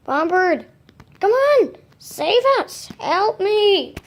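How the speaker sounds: high, loud pleading call for rescue; shrill panicked squeak of a trapped bird; desperate cry for help. Acted. Trapped toy bird begs Bombird to save them, high frantic plea.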